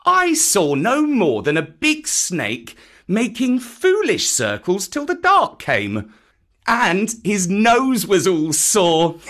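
put-on high, scoffing boy's voice; young boy. Boy making fun of the python, high boyish voice. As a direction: make light of it, slowing at the end. Boy mocks the snake's dance.

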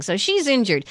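usual speaking voice

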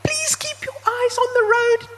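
very high, loud yelp; panicky yip. A narrator's reading. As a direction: yip it out, dropping the fake accent. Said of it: "Trevor yipped, forgetting his accent".

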